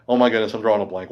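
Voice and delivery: ordinary talk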